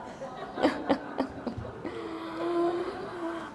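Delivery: high, soft laugh at herself; amused laugh after the bio joke; real laugh. Real, not acted. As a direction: let out an embarrassed giggle. Laughing at her own bio.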